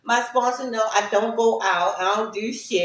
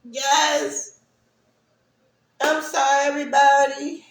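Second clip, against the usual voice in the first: teary apology; 5 semitones higher, 4 dB louder than usual. Real, not acted. Recovering from crying.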